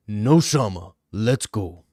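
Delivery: lightly deep, calm imitating Magomed; mock cool fighter delivery; aura voice. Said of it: "In just the most aura voice possible".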